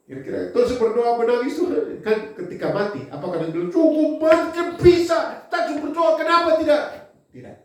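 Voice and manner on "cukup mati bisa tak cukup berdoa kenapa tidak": pleading protest. Acted. Preacher voicing David pleading against his son's death, high pleading. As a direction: cry it up high like a desperate father.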